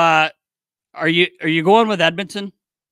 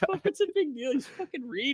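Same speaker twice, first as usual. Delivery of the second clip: mocking a teammate; 9 semitones higher, 8 dB quieter than usual. Mimicking Winter's table complaint.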